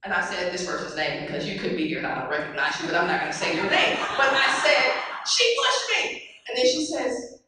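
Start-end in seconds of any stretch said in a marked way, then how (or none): 5.3-6.2 put-on much higher, childlike complaint; scared child voice. Girl telling her mother she was pushed, high childlike whine. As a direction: whine like a frightened nine-year-old.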